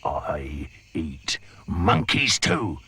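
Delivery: slow and low threat; menacing crocodile. Acted. The crocodile threatening the monkey, slow and low. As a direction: growl it slowly.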